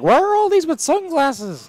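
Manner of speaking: squeaky confused voice asking why; mock baffled viewer. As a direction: viewer puzzling over the sunglasses emotes, high and slow. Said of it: "It's like".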